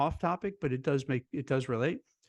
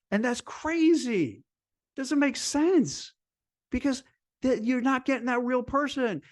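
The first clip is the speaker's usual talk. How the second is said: exasperated; 9 semitones higher than usual. Real, not acted. Exasperated rant about candidates.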